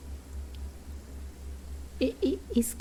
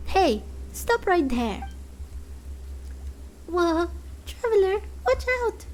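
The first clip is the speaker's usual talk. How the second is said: much higher and fast, squeaky alarm; alarmed Paimon; 8 semitones higher than usual. Acted. Paimon warning the traveler of a waking monster, high and fast. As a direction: yelp the warning to your friend.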